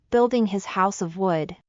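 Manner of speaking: usual speaking voice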